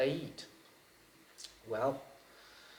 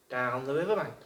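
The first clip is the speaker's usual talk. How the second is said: ordinary delivery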